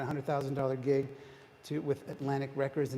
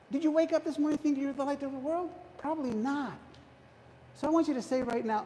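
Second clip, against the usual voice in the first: preaching; 11 semitones higher than usual. Acted. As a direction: lift the room with it. Preacher building to the key line.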